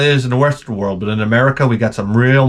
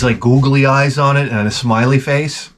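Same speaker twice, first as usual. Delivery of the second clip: usual speaking voice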